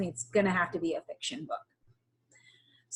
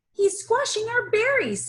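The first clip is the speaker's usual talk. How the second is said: high complaining voice for Bev; lightly voiced upset Bev; 12 semitones higher than usual. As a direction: Bev fussing over squashed berries.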